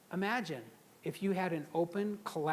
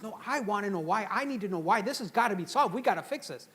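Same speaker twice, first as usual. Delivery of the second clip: insistent boss; 4 semitones higher, 4 dB louder than usual. Retold or imitated. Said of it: "He goes".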